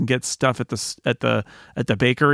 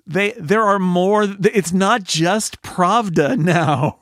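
slow, higher-pitched, drawn-out retort; mock remonstrating; 6 semitones higher, much slower than usual. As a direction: make the point slowly as the newsagent. Acting the news agent's retort.

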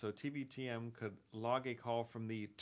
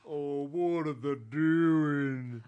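slower, louder Southern gentleman; Southern gentleman voice again; 7 dB louder, much slower than usual. Shown as a demonstration. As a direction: drag it out, Southern gentleman style. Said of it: "That's pretty good".

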